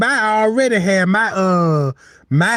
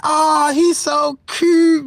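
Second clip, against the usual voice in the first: cooing nurse; 7 semitones higher, much slower than usual. Retold or imitated.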